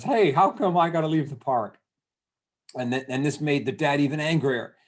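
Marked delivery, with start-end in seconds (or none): 0.0-1.8 put-on higher, louder childish whine of protest; mock crying child. Little sister made to leave the park, mock crying. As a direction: imitate a little kid's tearful complaint. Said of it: "her little sister starts crying".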